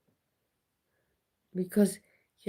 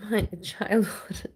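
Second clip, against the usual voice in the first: slow whispered laugh through the words; 3 semitones higher, slower than usual. Real, not acted. Recalling her childhood to viewers, whispered laugh. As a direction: laugh it off under your breath.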